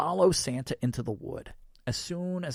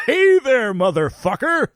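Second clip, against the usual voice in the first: loud, high, jolly holler; merriest Santa; 12 semitones higher, 13 dB louder than usual. A narrator's reading. Santa greeting the narrator in the snow, merriest voice. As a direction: boom out a hearty, merry hello. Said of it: "in the merriest voice I've ever heard".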